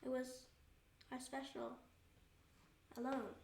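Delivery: soft, halting remembrance; hushed and tender with loss; wistful. Real, not acted. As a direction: let each phrase fall quietly, with long pauses. Remembering time with father after loss.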